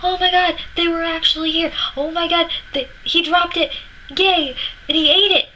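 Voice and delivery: put-on high, thrilled little-kid squeal; childhood excitement. Little girl finding Rudolph's apple core, squealing with excitement. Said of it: "I remember freaking out".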